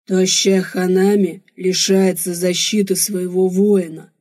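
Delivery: slow, loud alien warrior voice; blackmailing barbarian. A narrator's reading. Warrior warns the girl who refuses food, slow blackmail. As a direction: pronounce the threat slowly and loudly.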